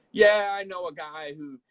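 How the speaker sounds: drawn-out name-dropping gossip; knowing insider. Retold or imitated. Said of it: "people who are like".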